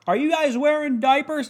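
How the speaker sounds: high-pitched mock host asking the girls; Steve Harvey impression. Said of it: "In his fucking voice, I gotta do it".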